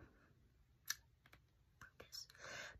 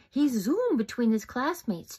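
plain reading voice

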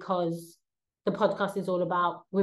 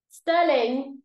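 calling the cat; 5 semitones higher, 5 dB louder than usual. Real, not acted.

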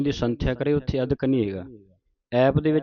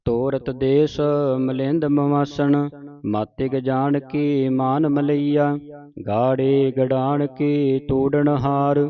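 measured chant-like verse reading; sustained teaching recitation; scripture recitation; 2 semitones higher, 5 dB louder, slower than usual. Shown as a demonstration. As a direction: recite in phrases, holding the long vowels.

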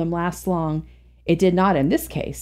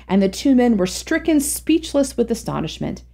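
ordinary delivery

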